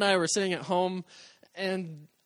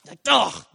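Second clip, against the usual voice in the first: disgusted ugh; 10 dB louder than usual. Real, not acted. Reacting to priest's words.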